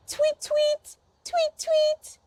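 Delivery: high, soft chirping tweets; bird tweets. Shown as a demonstration. Teacher chirping like a bird for the kids. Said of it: "What does a shimbiro say?".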